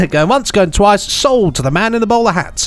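auctioneer calling the sale; rising auctioneer call. Pretend. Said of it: "Sound like an auctioneer".

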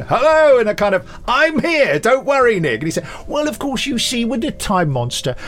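very high, loud jolly cheer; hammed-up jolly rescuer voice. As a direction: bound on cheerfully to rescue him. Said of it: "just came on and went".

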